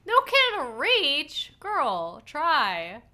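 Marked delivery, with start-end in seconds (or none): 0.1-1.5 high, loud, slow mocking repeat; mocking echo. Player mocking the character's complaint, slow and incredulous. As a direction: parrot her words back in disbelief.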